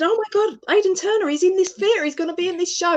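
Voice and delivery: high, thrilled gasp of discovery; excited fan. Retold or imitated. Fan telling friend she spotted the actor's billboard, excited. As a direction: retell it high-pitched, as if just spotting the billboard. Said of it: "And I said".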